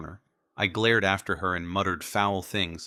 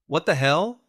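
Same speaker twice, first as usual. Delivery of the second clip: higher, louder startled outburst; shocked blurt; 8 semitones higher, 6 dB louder than usual. Acted. Nate startled by Ivy's sudden screaming, blurting. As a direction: blurt it out in disbelief. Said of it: "I blurted".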